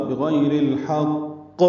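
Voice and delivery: بغیر الحق slow, qaf pressed then released; بغیر الحق with a clear qalqala on the qaf; correct qalqala. Shown as a demonstration. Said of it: "دبا کر رک کر قلقلہ کریں گے".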